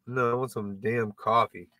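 ordinary delivery

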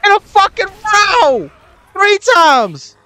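high, strained incredulous rant; exasperated disbelief. Real, not acted. Ranting at repeated move in game.

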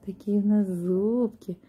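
ordinary talk

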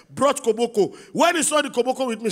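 ordinary talk

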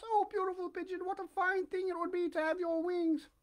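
very high puppet voice, wishing; wistful Pinocchio. A narrator's reading. Puppet admiring the pigeon's wings, high wistful voice. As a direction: sigh with longing in a high little voice.

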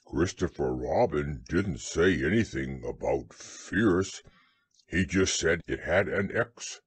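low bear voice; deep, simple-minded storybook bear; Pooh. A narrator's reading. Bear answers worried Piglet, low voice.

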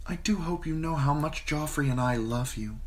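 put-on a queen cooing to a frightened girl; queen Cersei, sweet and smooth. A narrator's reading. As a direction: Cersei soothing Sansa in a honeyed voice. Narrator voices Queen Cersei.

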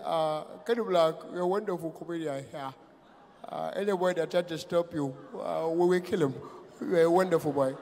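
soft, understated presidential praise; Muhammadu Buhari. Retold or imitated. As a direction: murmur it gently with small hesitations. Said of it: "our president has got the sexiest voice".

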